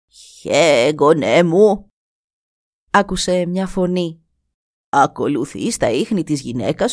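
χαμηλότερη, σοφή φωνή γριάς; καλωσόρισε τον ξένο σαν γιαγιά; γριά γυναίκα. Acted. Η γριά καλεί τον νεαρό. (η γριά καλεί τον νεαρό στη σκηνή της, χαμηλή φωνή γριάς)

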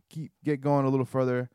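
normal talking voice

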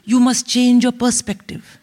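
mock-solemn Indian sage, rising to a climax; erudite father. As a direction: lecture grandly with an Indian accent. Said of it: "my father's very erudite".

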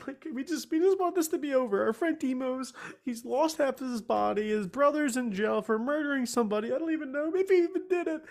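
pitched high, voice close to breaking; real tearful pleading. Real, not acted. Weeping adventurer begs the Furbolg for help, tearful and high. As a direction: beg through real tears. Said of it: "I'm really crying".

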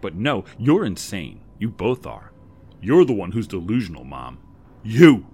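pointing at her mother, rising to a yell; building to a scream. A narrator's reading.

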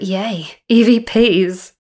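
a loud, slow, delighted cry of yay; excited cheer. Real, not acted. Podcast host cheering to listeners about ghost recordings, loud delight. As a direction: cheer the word out like a fan.

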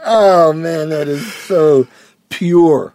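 high, loud laughing delight. Retold or imitated. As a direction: marvel at it through laughter. Own reaction retold, laughing.

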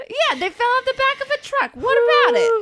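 high cartoon mobster weasel; hammed-up, high-pitched wise guy; gangster weasel. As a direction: play a squeaky old-movie henchman. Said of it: "You sound like the weasels".

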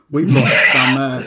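cracking up mid-line in a West Country voice; can't hold the accent for laughing; laughing through the accent. Acted. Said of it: "It's such a fun accent to do".

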